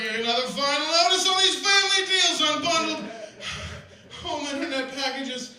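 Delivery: building indignation with laughs; mounting mock outrage. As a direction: read the letter like it's an insult. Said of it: "I got real into that last one".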